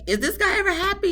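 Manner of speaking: high, fast, incredulous question; skeptical quip. Retold or imitated. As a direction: toss it off in disbelief. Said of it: "I'm like".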